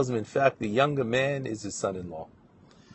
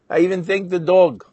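"I even thanked the dog" higher and louder; emphatic topper; 5 semitones higher, 7 dB louder than usual. Retold or imitated. Student insisting to his rabbi on his gratitude, emphatic.

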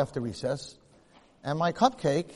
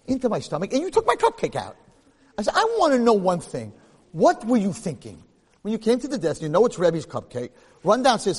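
higher and louder, building exasperation; retold scolding; 6 semitones higher, 6 dB louder than usual. Rabbi scolding the student who ate his cupcake, exasperated. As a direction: scold the boy who ate it, rising to a peak. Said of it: "I was so upset".

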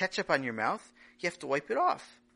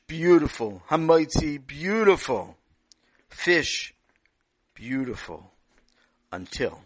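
storytelling narration; 8 dB louder than usual. A narrator's reading.

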